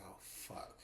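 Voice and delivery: very soft, voiceless curse; whispered dread under the breath; dismayed. Retold or imitated. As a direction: imitate a sinking realization. Woman dreading bad news.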